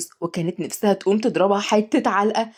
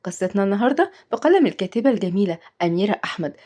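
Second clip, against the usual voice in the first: ordinary delivery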